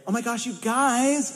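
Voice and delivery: high-pitched, exaggerated Californian greeting; Californian accent. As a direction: send up your own Californian way of talking. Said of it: "he's from California".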